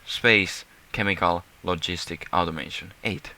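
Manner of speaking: normal talking voice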